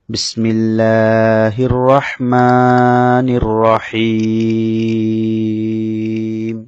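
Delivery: slow, drawn-out melodic tajweed recitation; slow sung Quranic tilawa. Shown as a demonstration. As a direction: chant each word long and measured.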